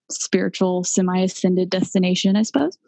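normal talking voice

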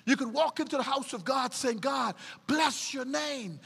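preacher repeating it as a shout. Shown as a demonstration. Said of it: "repeated with a shout".

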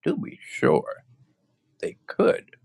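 high, airy, excited cry; eager Scarecrow. Acted. Scarecrow agreeing with Dorothy, high airy delight. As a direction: breathe it out in delight.

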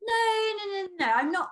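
ordinary talk, nothing marked

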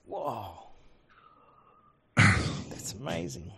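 slow breathy mock amazement; sarcastic whisper. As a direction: whisper it with dry irony. Sarcastic reply to hyped article.

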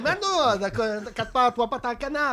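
high, laughing put-on Chinese accent; lightly voiced Chinese accent, reassuring; mock Chinese accent. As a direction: play the Chinese owner, half laughing. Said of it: "l'accent chinois".

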